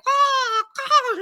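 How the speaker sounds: high squawking bird caws; high imitated grackle squawks. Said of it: "they're like".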